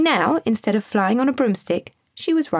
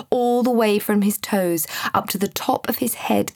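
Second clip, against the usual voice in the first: ordinary delivery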